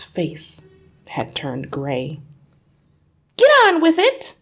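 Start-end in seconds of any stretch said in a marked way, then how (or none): 3.4-4.4 very high, loud command; impatient hiss. A narrator's reading. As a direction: urge him on impatiently. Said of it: "hissed the mother".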